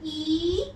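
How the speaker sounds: "ई" held as a long "ee"; long E sound. Shown as a demonstration. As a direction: stretch "ई" like the letter's name E. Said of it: "long sound". ("ई" को लंबा खींचकर)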